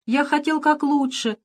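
high whiny plea; whimpering. A narrator's reading. Pirate whines to his friend, high and whiny. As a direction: whine it like a hurt child. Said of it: "захныкал весельчак У".

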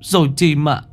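put-on high, shaken child voice, tripping over words; a scared boy explaining to the elders what happened; stammering child. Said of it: "Một đứa lắp bắp". (giọng trẻ con lắp bắp, run sợ)

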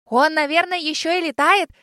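put-on boy thrilled about the dragon; admiring boy. A narrator's reading. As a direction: marvel at the dragon like a kid. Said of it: "с восхищением сказал мальчик".

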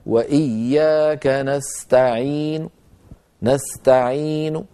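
slow recitation, نستعين ending in a faint u; rawm without lengthening. Shown as a demonstration. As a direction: stop on نستعين with a short madd and partial damma. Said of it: "بل يقصر كالوصل".

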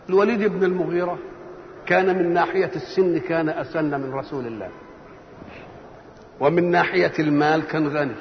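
ordinary delivery

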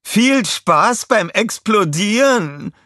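high, slow gloating villain voice. Acted. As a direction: savor the cruel goodbye.

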